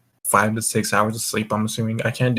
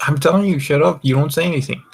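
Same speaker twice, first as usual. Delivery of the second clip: raised, scolding voice; telling off; 5 semitones higher, 4 dB louder, faster than usual. Real, not acted. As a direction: hush him up sharply, half joking. Telling friend to keep quiet.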